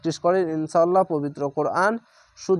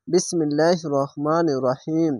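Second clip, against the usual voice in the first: ordinary talk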